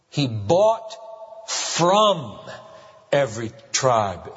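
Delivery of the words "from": from los, traag en nadrukkelijk; stress on from. Shown as a demonstration. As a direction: leg alle gewicht op from. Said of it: "the reason I stress this".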